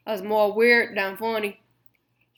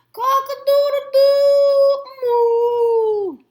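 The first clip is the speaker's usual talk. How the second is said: high, loud, slow strained crow ending in a moo; cow crowing like a rooster; 10 dB louder, much slower than usual. Acted. Cow on the fence tries to wake the farm, loud high crow. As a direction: belt out a rooster call that slips into moo. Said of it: "She tried to yell".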